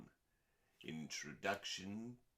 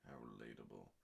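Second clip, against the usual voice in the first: sarcastic aside; 10 semitones lower, 13 dB quieter, faster than usual. Real, not acted. Sarcastic aside mid-reading.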